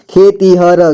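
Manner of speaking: खेतीहर with final र sounded separately; syllable by syllable. Shown as a demonstration. Teacher breaking down खेतीहर for learners, syllable by syllable. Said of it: "जोड़ जोड़ करके".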